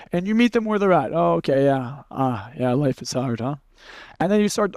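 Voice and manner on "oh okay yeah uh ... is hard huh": matching a depressed mood. Shown as a demonstration. Coach meeting a depressed person where they are, mildly low and gloomy. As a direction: sink down to a flat low murmur. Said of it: "and they're depressed".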